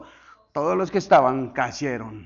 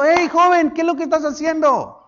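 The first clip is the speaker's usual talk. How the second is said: much higher, loud calling voice; voice of God; 11 semitones higher, 7 dB louder than usual. Acted. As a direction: boom out at the young man like God. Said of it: "La misma voz de Dios le estaba diciendo". (voz de Dios muy aguda y fuerte)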